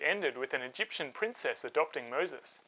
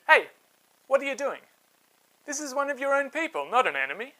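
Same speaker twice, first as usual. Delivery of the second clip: higher, louder rebuke; scolding; 8 semitones higher, 5 dB louder, faster than usual. Acted. Moses scolding two Israelites in a fist fight, sharp rebuke.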